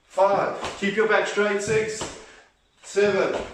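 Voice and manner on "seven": normal talking voice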